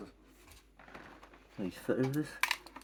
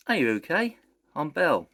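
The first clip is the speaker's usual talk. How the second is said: excited greeting; 7 semitones higher, 6 dB louder than usual. Acted. Belle greets visitor excitedly.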